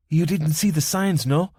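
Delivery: put-on amused and easy, with a soft Spanish accent. Young woman at the car window teasing the driver, soft Spanish accent. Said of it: "she said in a soft Spanish accent".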